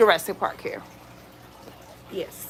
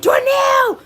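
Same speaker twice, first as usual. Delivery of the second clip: high frantic shout for help; mock panicked call for Darnell; panicked yell; 4 dB louder, slower than usual. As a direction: scream his name across the yard. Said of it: "I went and ran and said".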